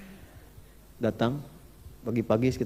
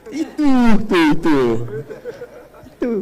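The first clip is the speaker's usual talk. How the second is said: loud laughing emphasis; 4 semitones higher, 10 dB louder than usual. Real, not acted.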